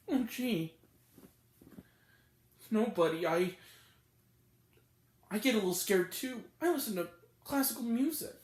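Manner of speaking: lightly voiced kid, low and soft; imitating child actor. Critic mimics the little kid's acting, soft side-of-mouth mumble. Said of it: "He talks out of the side of his mouth".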